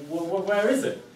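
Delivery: put-on eager boy stumbling over the words; stammering boy.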